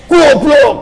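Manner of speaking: high, loud shouted insult; furious yell at close range; angry shout. Retold or imitated. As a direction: bark it at someone sitting right beside you. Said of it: "deket tapi teriak".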